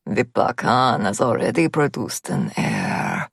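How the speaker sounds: put-on slow, low Russian-accented sneer; amused menace in a heavy Russian accent. A narrator's reading. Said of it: "he chuckles".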